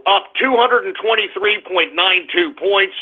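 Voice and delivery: ordinary talk, nothing marked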